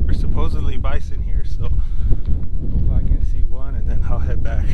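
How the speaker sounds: ordinary talk, nothing marked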